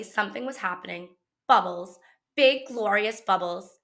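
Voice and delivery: ordinary delivery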